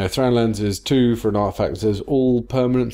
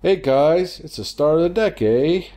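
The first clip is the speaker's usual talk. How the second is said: higher-pitched, put-on Canadian accent; 6 semitones higher than usual. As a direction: do a playful Canadian "eh" voice. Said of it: "There's my Canadian accent for you".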